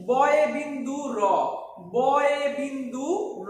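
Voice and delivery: বয়ে বিন্দু র drawn out slowly, each syllable clear; teacher says বয়ে বিন্দু র slowly for pupils to copy; বয়ে বিন্দু র spoken slowly and deliberately; slow careful pronunciation. Shown as a demonstration. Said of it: "বয়ে বিন্দু দেওয়ার কারণে এটা হয়ে গেছে".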